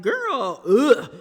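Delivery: loud fed-up ugh; exasperated. Real, not acted. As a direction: groan at her choice. Exasperated at the wife.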